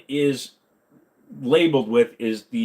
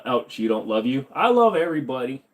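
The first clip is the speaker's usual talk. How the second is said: ordinary talk, nothing marked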